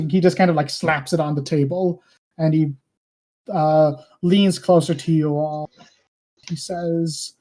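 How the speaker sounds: usual speaking voice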